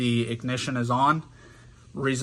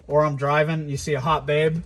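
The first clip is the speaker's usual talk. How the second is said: ordinary talk, nothing marked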